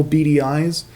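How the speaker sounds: normal talking voice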